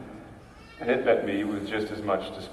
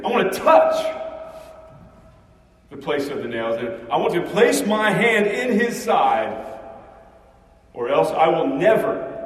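raised, insistent refusal; emphatic; 4 semitones higher than usual. Acted. Thomas to the disciples, emphatic and stubborn. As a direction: lay down the conditions firmly. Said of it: "rather emphatically".

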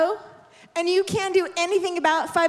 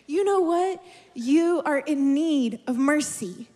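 normal talking voice

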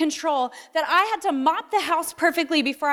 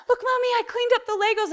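put-on higher, eager toddler voice; proud little boy; 8 semitones higher, faster than usual. Little boy showing mommy the Legos he cleaned, high proud child voice. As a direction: beam it up like a toddler proud of tidying.

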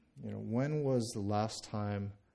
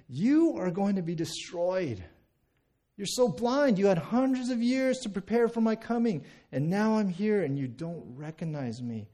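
raised, loud, condemning outburst; high, forceful acted rebuke; righteous anger; 6 semitones higher, 4 dB louder than usual. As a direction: pronounce judgment with rising force. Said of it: "look down on the city in anger".

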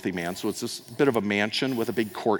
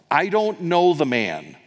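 curt denial; 6 semitones higher, 5 dB louder, slower than usual. Acted. Peter denies Jesus.

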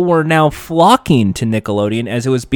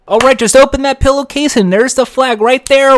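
eager host; 10 semitones higher than usual. Retold or imitated. Host coaching the kid.

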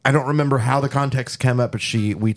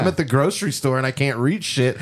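normal talking voice

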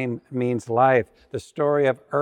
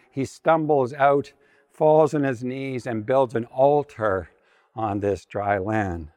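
ordinary talk

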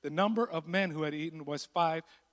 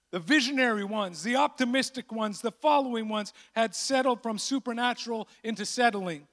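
usual speaking voice

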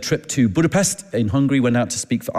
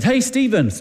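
calling out; 12 semitones higher, slower than usual. Retold or imitated. Calling after man leaving plane.

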